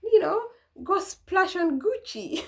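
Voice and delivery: high, showy boast; flashy spender. Retold or imitated. Someone boasting about spare change for Gucci, high and showy. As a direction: brag like you have cash to burn. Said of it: "to be like".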